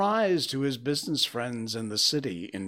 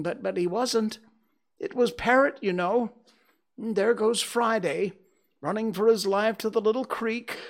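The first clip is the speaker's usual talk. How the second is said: raised, eager storytelling as Scrooge; between laughing and crying; 8 semitones higher, 5 dB louder than usual. A narrator's reading. Scrooge reliving Robin Crusoe's adventures, excited. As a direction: get carried away reliving the tale. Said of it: "between laughing and crying".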